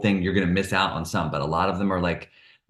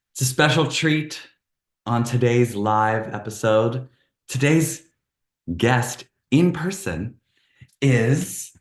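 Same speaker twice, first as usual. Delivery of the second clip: excited build-up; 5 semitones higher, slower than usual. Acted. Hyped guest introduction build-up.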